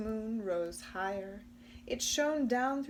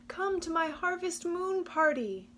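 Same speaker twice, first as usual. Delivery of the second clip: higher, louder eager invitation; calling invitation; 9 semitones higher, 5 dB louder than usual. Acted. Possum inviting the mice to his party, eager and loud. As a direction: call them over with excitement. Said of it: "Possum cried".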